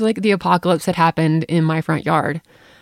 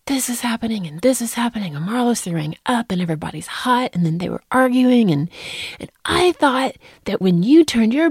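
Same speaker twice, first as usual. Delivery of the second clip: put-on higher, whiny teen rant; mock her frantic venting; mimicking upset daughter; 6 semitones higher than usual. Mom retells daughter's meltdown.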